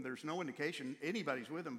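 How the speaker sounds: usual speaking voice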